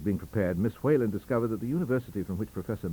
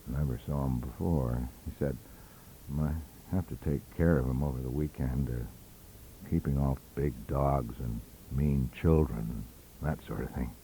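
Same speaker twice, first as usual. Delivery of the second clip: low mutter; 7 semitones lower, 4 dB quieter than usual. A narrator's reading. Embarrassed Zing mutters reply.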